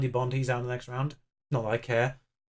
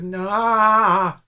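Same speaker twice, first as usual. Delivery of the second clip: loud high wail of despair; anguished cry; 5 semitones higher, 8 dB louder than usual. Real, not acted. Gamer cries out as the ball hits the bar. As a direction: let out a pained animal-like cry at the miss. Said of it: "pseudo farmyard animal noise".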